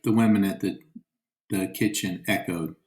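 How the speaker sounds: ordinary delivery, nothing marked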